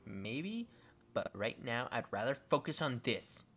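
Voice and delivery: low Victoria voice. Acted.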